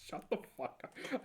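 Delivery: whispering. Real, not acted. Sudden soft aside.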